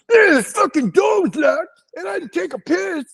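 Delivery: high, strained mock yell; imitated drunken tantrum, way up high; indignant drunk. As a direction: squeal the excuse in hammed-up outrage. Drunk friend defends kicking door in.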